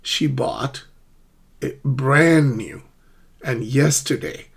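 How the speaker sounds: pauses around "bought", a drawn-out "brand", "yesterday"; stressed words isolated. Shown as a demonstration. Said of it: "the stress is on".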